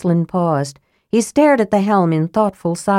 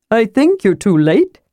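light, higher kender voice; cheerfully matter-of-fact; Tass the kender, chipper; 4 semitones higher, 3 dB louder than usual. Acted.

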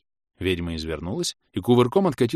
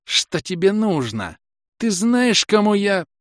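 high, rising, threatening voice; hissing witch; 12 semitones higher, 6 dB louder, slower than usual. A narrator's reading. Witch threatening her attacker, hissing and rising. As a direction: build from a slow demand to a loud high threat. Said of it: "прошипела ведьма".

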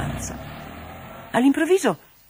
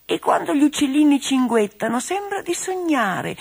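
gentle teddy bear; 10 semitones higher than usual. Acted. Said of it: "grugniva dolcemente l'orsacchiotto".

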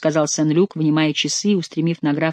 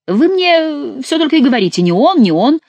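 high, loud exasperated objection; indignant protest; 10 semitones higher, 5 dB louder than usual. A narrator's reading. Saint-Luc objects to being questioned, indignant protest. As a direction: protest heatedly, pitch thrown right up. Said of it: "запротестовал Сен-Люк".

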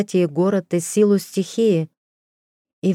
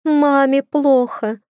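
put-on high, slow pleading child's cry; a frightened boy begging for help; scared child; 8 semitones higher, 3 dB louder, slower than usual. A narrator's reading.

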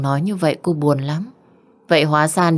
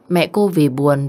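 ordinary delivery

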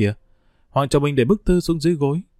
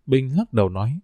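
usual speaking voice

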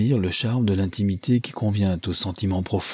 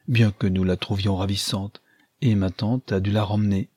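ordinary delivery

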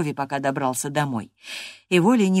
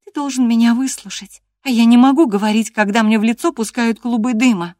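Catherine's voice; 7 semitones higher, 8 dB louder than usual. Acted. Catherine scolding Hareton.

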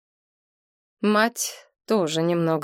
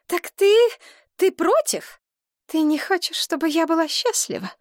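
much higher, wounded pleading; hurt mother; 10 semitones higher than usual. A narrator's reading. Mother asking her daughter if she opposes her, hurt. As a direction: ask as if betrayed.

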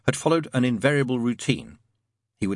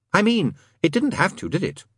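higher, mildly manic questioning; 9 semitones higher than usual. A narrator's reading. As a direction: press the point with manic eagerness. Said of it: "a manic look in his eye".

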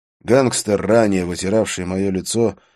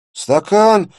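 put-on higher, louder gurgling boy's yell; gurgling indignant yell; 14 semitones higher, 10 dB louder than usual. A narrator's reading. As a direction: yell it out in a strained, bubbling voice. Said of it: "возмущённо завопил малец натруженным булькающим голосом".

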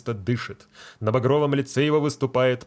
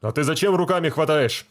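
angry; 5 semitones higher, 5 dB louder than usual. Acted. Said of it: "сердится фельдшер".

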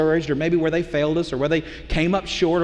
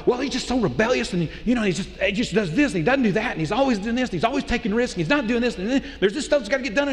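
a hint of a frustrated wife, higher and rising as the list grows; 5 semitones higher than usual. Wife complains to the pastor about her husband, mildly exasperated. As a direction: reel off his faults like an exasperated wife.

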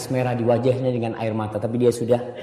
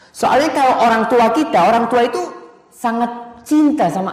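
high, loud sermon voice; fervent preacher, high and loud; preaching at full force; 10 semitones higher, 5 dB louder than usual. Acted. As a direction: drive the point home to the congregation. Preacher raising voice.

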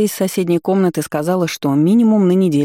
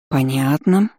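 «Понятно» stretched out with a rising end; drawn-out; 3 semitones lower, slower than usual. A narrator's reading. Friend takes in a vague description of the husband, drawn-out «Понятно». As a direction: draw out «Понятно», letting the end lift. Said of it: "опять протянула она".

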